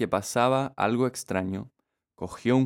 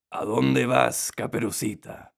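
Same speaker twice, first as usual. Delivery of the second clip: hoarse wolf voice. A narrator's reading. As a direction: rasp it out slyly like a wolf. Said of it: "oyó una voz ronca". (voz ronca de lobo)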